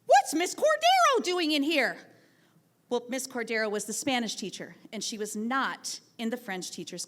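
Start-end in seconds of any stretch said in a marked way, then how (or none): 0.1-2.0 sudden, louder, higher outburst; blurted out. Retold or imitated. Student blurting a question into a silent class, loud and sudden. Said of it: "Jacob suddenly blurted out".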